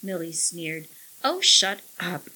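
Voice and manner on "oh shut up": sharp, louder snap; snapped. A narrator's reading. Millie snapping at the parrot, irritated. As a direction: bite it off at the bird. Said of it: "she snapped at the bird".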